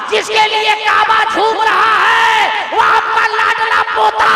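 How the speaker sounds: soaring high sermon shout, words drawn out; full-force preaching. Acted. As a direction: preach it at the top of your voice like a joyful proclamation. Preaching climax, quoted mother's joy.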